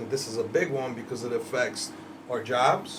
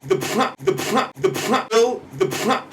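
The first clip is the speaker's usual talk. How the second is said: mocking nickname; 7 semitones higher, 4 dB louder, slower than usual.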